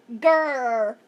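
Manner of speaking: loud bear growl. Acted. Bear growling at the fainting teacher, loud growl. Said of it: "growled the bear".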